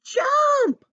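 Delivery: very high, loud, drawn-out shout; urgent cry. A narrator's reading. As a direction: yell the command at full pitch. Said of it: "cried Grandude".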